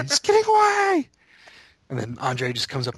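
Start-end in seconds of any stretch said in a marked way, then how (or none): 0.0-1.1 high, loud frantic cry; hammed-up high-pitched alarm; imitated screaming. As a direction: yell it like a desperate film hero. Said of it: "screaming for Fezzik".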